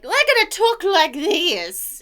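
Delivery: mock upper-class English accent; posh English accent. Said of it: "posh people were like".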